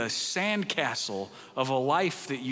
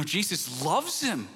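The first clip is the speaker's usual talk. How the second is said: ordinary delivery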